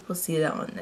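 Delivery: normal talking voice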